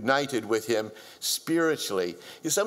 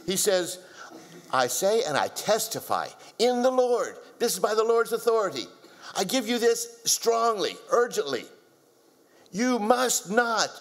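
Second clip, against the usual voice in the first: higher, urgent sermon voice building force; emphatic preaching; 6 semitones higher than usual. Acted. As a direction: press the warning home like a preacher. Preaching with rising intensity.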